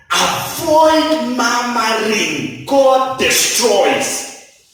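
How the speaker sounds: each word spaced out slowly; slow emphasis. Acted. Preacher pleading with the brethren, slow and weighty. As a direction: lay it down word by word.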